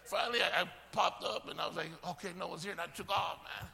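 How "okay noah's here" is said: soft, lower voice; cautious retold aside. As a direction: say it low, as if checking the coast is clear. Retells peeking out after hiding.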